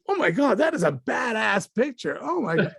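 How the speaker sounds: high-pitched, awestruck outburst; amazed exclamation. Retold or imitated. Director reacting to the actor's headshot, amazed. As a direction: imitate someone blown away.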